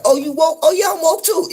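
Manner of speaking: high, loud mock voices trading lines; mock woke exchange. Mimicking people bragging about being woke, high and loud. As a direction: play two people proudly calling each other woke.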